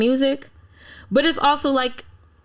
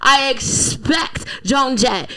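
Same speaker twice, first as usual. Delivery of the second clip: hyped listing; 5 dB louder, slower than usual. Real, not acted. Listing legends she expects.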